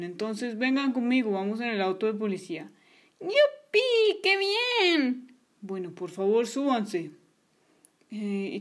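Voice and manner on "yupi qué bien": put-on high delighted kid squeal; child cheering about riding in the police car; excited cheer. Kids cheer police car ride.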